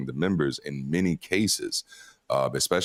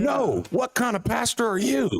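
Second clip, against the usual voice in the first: much higher, hammed-up scandalised believer voice; mock outraged churchgoer. Mocking church members scolding their pastor, in a high hammed-up outraged voice. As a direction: play a pearl-clutching congregant, way up high.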